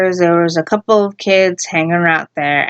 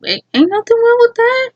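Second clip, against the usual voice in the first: much higher, put-on teasing voice; high falsetto tease; mock innocence; 14 semitones higher than usual. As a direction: squeak it out in a mock-sweet tone. Mocking the guy's answer.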